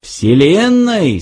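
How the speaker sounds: high, drawn-out fervent cry; passionately exclaimed. A narrator's reading. Lunkwill caps Fook's answer, passionately exclaimed. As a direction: stretch the word out rapturously, pitch soaring. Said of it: "страстно воскликнул Ланквилл".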